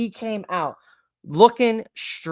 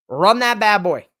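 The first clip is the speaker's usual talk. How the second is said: ordinary talk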